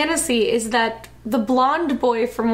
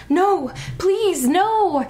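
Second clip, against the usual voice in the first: higher, mock-desperate begging; acted plea, pitched up; pleading; 6 semitones higher, much slower than usual. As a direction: beg in a hammed-up high voice. Daughter pleading before the gunman.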